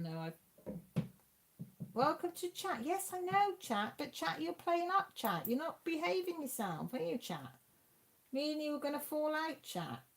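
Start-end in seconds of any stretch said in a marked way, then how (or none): 1.9-10.0 high-pitched playful telling-off, dropping on "chat"; singsong pretend reprimand; mock scolding. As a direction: tell off a naughty chat in a high, mock-cross voice.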